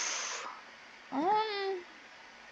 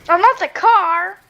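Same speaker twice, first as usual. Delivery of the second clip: alarmed cry; 7 semitones higher, 7 dB louder, slower than usual. Real, not acted. Player's car about to be hit.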